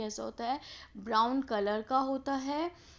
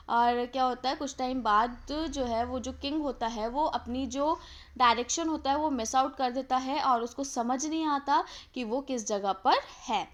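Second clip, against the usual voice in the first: usual speaking voice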